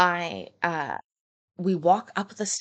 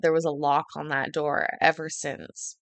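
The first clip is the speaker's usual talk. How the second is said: ordinary talk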